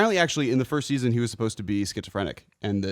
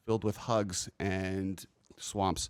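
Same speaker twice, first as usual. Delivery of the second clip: slow deadpan; 2 semitones lower, 6 dB quieter, much slower than usual. Acted. Slow comic punchline.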